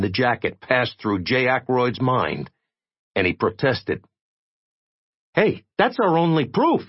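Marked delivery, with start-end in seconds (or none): 5.3-6.8 much higher, objecting voice; protesting young man. Acted. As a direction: push back, sure of yourself. Jay objects to Tachyon.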